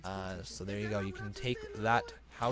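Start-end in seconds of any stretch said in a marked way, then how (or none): none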